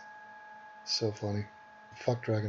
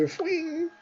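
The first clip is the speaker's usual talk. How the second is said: very high thin blade swish; a dagger flying; whoosh sound effect; 4 dB quieter than usual. Retold or imitated. Mocking her word daggers with a flying-dagger swish. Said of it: "word daggers".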